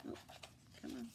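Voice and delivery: ordinary delivery, nothing marked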